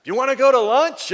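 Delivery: high, loud invitation across the park; loud cheerful call. Retold or imitated. Calling invitation across distance.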